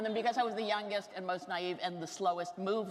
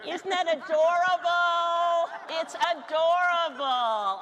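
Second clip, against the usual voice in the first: gushing delight; 9 semitones higher, 9 dB louder, slower than usual. Real, not acted. Gushing over cute meme.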